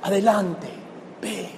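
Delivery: soft, compassionate send-off; Jesus softly sending the woman on her way with compassion. Retold or imitated. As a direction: gently urge her onward with compassion. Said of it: "con mucha compasión".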